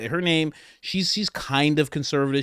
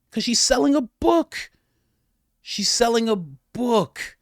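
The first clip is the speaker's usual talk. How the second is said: sarcastic reveal; 9 semitones higher, slower than usual. Real, not acted.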